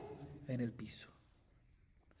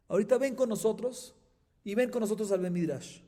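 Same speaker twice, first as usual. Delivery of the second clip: apologetic rabbi; 8 semitones higher, 8 dB louder than usual. Acted. Rabbi apologizes to revived student.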